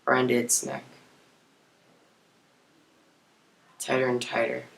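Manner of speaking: hushed, halting confession with long pauses; dark confession. Acted. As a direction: let each phrase fall quietly into silence. Monologue turns to strangling the bird.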